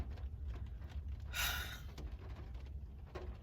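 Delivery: long, soft exhale; long sigh. Real, not acted. Fan pausing after remembering her start, long soft sigh. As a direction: let out a drawn-out quiet sigh.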